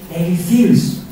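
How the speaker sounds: normal talking voice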